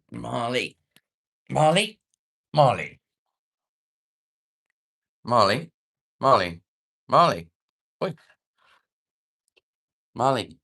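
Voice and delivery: loud sing-song call of a pet's name; calling the dog. Real, not acted. Owner calls his dog Marley over, loud call.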